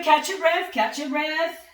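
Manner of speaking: normal talking voice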